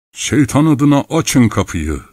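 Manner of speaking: high, drawn-out shout; shouted demand. A narrator's reading. Someone outside demands the hut door opened, shouting. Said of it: "diye bağırıldı".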